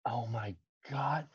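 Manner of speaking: whispered, slow and low; hushed awe. Real, not acted. Listener awed by the monkey rescue story, hushed. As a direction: breathe it out in stunned admiration.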